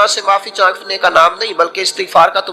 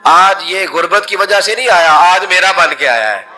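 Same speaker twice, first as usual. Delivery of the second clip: high, full-voiced storytelling at the peak; impassioned preaching; 6 semitones higher than usual. Preacher tells the congregation of the man's true repentance, in impassioned full voice. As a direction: carry the crowd to the tearful repentance.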